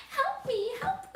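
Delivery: very high, loud squeal; bunny crying for help. Acted. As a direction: shriek it like a lost bunny. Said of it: "the bunny cried".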